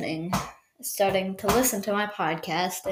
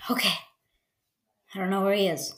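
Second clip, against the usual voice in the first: ordinary talk, nothing marked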